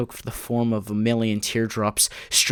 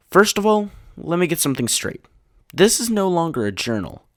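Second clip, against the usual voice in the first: high, loud, emphatic insistence; agitated declaration; 9 semitones higher, 5 dB louder than usual. A narrator's reading. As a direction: set it straight with raised, heated voice. Agitated diary writer declares his intent.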